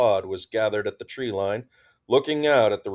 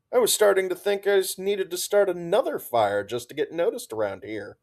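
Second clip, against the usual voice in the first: high and quick teasing; playful; 9 semitones higher than usual. A narrator's reading. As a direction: rattle it off playfully. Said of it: "Crispy playfully made her presence".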